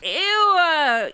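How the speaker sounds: loud, high gross-out squeal; disgusted ew. Acted. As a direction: recoil from the snot with a loud ew. Horse snorted snot on her.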